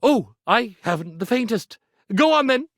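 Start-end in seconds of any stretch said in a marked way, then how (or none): none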